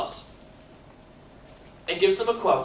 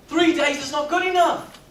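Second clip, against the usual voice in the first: ordinary talk, nothing marked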